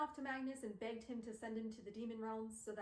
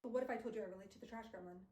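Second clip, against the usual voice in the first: normal talking voice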